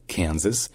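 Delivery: "Kansas" with the first s voiced as z, KAN-zas; "Kansas" said KAN-zuhs. Shown as a demonstration. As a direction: buzz the middle s of "Kansas". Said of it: "le premier S est prononcé comme un Z".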